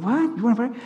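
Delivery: a lightly voiced surprised stranger. Imitating a stranger startled by the offer, surprised. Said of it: "the stranger's surprised reply".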